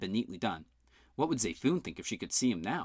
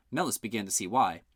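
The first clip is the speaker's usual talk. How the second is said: ordinary delivery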